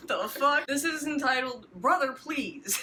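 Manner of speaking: normal talking voice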